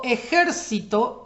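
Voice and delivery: ejército with JÉR stressed; ejército, voice loaded onto JÉR; stress on antepenultimate syllable. Shown as a demonstration. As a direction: hit the third syllable from the end: e-JÉR-ci-to. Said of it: "es esdrújula".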